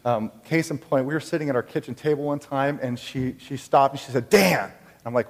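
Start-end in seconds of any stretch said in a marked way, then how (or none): 4.3-4.6 louder, higher retold cry of alarm; sudden call. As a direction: call the name out abruptly, as if startled. Wife suddenly calls out a warning.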